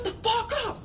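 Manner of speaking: high, thin mocking voice of the angry cellmate; mock cellmate snap. Cellmate yelling at them again, high mocking voice. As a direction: mimic the grumpy guy telling them to hush. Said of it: "he's like".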